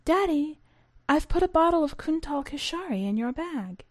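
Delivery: put-on young daughter teasing. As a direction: tease your father like a little girl. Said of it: "my daughter's joke".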